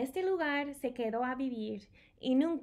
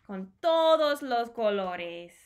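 wonder; 8 dB louder than usual. A narrator's reading. Rainbow colours, emphatic.

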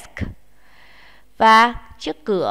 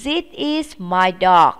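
slow, firm English drill; slow teacher model; slow model sentence; 4 dB louder, slower than usual. Shown as a demonstration. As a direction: spell it out slowly for the class. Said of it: "chúng ta nói nhé".

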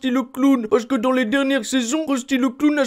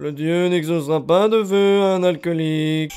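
Reverend Lovejoy voice; 7 semitones lower than usual. Acted. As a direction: lower solemn preacher voice. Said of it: "Révérend Lovejoy". (voix du révérend Lovejoy)

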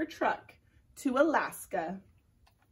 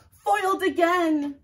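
higher, louder, slow defeated villain; cartoon villain; 7 semitones higher, 8 dB louder, slower than usual. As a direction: grumble like a foiled villain. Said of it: "said the germ".

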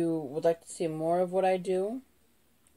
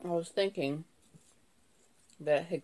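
talking past a chip; chip held to lips. Real, not acted. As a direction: lips touching food. Seen 3: Chip pressed against lips while talking; chewing not clearly visible.

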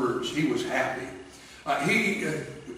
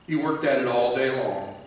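ordinary delivery

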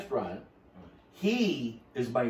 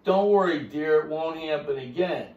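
mock apologetic; 5 semitones higher, 4 dB louder than usual. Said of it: "I go".

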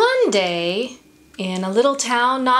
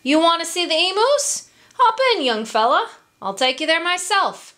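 high, fast, bouncy kangaroo voice; friendly kangaroo; 7 semitones higher, faster than usual. A narrator's reading. Kangaroo offering Emu a lift, quick and high. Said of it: "the kangaroo greeted Emu".

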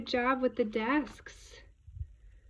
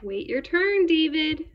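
loud, high teacher's command; raised-voice classroom telling-off; scolding teacher; 6 semitones higher, 7 dB louder than usual. A narrator's reading. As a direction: order a pupil like a cross teacher. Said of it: "David's teacher always said".

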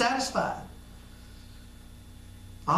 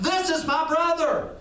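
proud yell; 8 semitones higher than usual. Acted. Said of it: "he yells out".

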